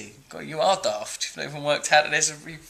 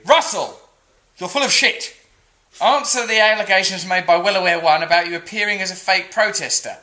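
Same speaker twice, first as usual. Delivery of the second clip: louder, higher hectoring read; mock accusation; 4 semitones higher, 8 dB louder than usual. Reading a hostile comment aloud, hammed-up angry shouting.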